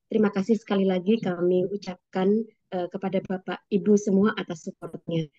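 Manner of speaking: ordinary talk, nothing marked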